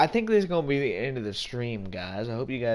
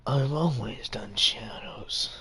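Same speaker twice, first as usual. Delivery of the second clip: a hint of Shadow, whispered; dark whisper behind you. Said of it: "Heard you do it".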